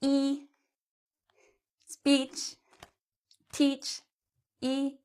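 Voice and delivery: E speech teach with the E held long and quiet. Shown as a demonstration. Teacher shows students a long E sound. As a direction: stretch out each E. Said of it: "is it a long sound or a short sound".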